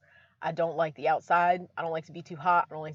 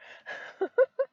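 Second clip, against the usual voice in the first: high giggle. Real, not acted. Joking about a cooler fund.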